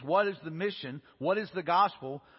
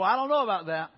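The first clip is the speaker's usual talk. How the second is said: louder, doubting scoff at the news; loud skeptical doubt; 2 semitones higher, 6 dB louder than usual. Retold or imitated. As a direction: push back hard, disbelieving. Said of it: "We go".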